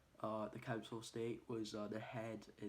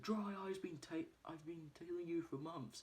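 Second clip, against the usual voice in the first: pleading confession; 7 semitones higher than usual. Retold or imitated. Man from the boot under questioning, pleading. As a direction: imitate a captive owning up.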